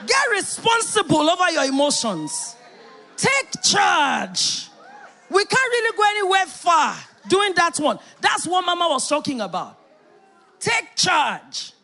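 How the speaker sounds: preacher shouting at congregation to take charge of emotions; commanding shout. Real, not acted. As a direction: bark it at the congregation. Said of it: "preacher commanding congregation".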